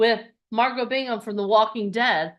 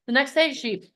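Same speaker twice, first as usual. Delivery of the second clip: ordinary talk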